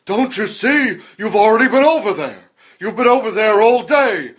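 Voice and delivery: put-on high, loud eccentric old-actor voice; weird Christopher Lloyd. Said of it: "sounds like a weird Christopher Lloyd".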